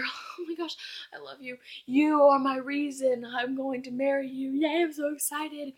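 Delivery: mock the sappy lovers; a touch of mock romance; lightly mocking lovebirds. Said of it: "one minute they're".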